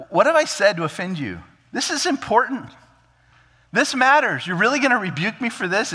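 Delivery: high-pitched, exasperated pleading; indignant protest. Acted. Little brother protesting a rebuke from the eldest, indignant. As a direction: protest with rising pitch, in disbelief.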